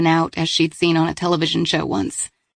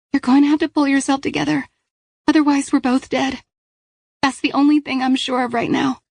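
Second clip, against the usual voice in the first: high, firm urging; young woman voice; 8 semitones higher than usual. Acted. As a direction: tell her to pull together. Paige to Kat.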